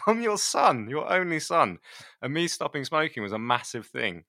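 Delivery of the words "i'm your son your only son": high, mock-wounded protest; mock indignant son. Son reminding dad he quit smoking, high mock indignation. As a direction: ham up the hurt, voice pitched well up. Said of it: "I was like".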